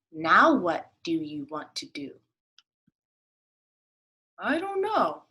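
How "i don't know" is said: higher duck, shrugging. Acted. Duck answering the rabbit, higher. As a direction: toss it off lightly.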